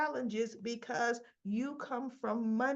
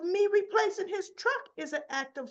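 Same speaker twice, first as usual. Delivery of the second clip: incredulous retort; 9 semitones higher, 4 dB louder than usual. Retold or imitated. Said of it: "She goes".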